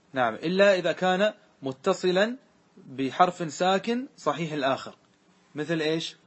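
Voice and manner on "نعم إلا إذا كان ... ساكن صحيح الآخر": usual speaking voice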